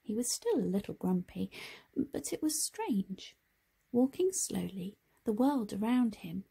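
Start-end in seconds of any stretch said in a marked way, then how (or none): none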